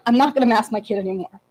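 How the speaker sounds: ordinary talk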